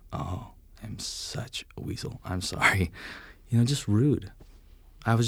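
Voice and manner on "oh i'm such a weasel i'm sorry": slow, ashamed whisper; sheepish whisper. Retold or imitated. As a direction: breathe it out, embarrassed.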